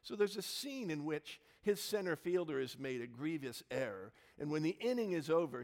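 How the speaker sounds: ordinary talk, nothing marked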